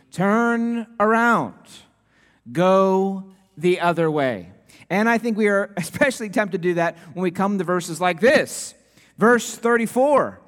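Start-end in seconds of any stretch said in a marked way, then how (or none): 0.1-4.5 slow, even satnav command; mock GPS telling you to turn; imitating the GPS voice. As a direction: mimic the navigation voice. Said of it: "I don't want to hear you reminding me".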